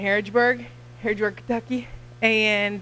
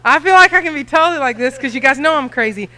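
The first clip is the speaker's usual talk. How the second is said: louder and higher, laughing as she talks; 5 semitones higher, 9 dB louder than usual. Real, not acted. Missionary laughing at the stalled slideshow to the church, laughing. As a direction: laugh it off, giddy.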